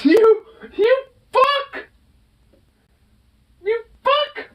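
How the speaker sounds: high-pitched laughing curse at the pet; laughing outrage. Real, not acted. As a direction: laugh through mock outrage. Pet snatched the treat.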